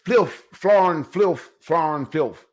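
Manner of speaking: mock Cosby ranting nonsense words; lightly done Cosby scolding impression; Bill Cosby gibberish. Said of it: "Bill Cosby did that".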